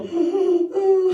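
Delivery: loud high gasp for air; winded gasp. Retold or imitated. Winded boy under bleachers, gasping for air. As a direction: suck in air like you've just been punched.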